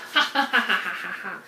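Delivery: hammed-up villain cackle; evil scientist laugh. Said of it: "we're like an evil scientist experimenting".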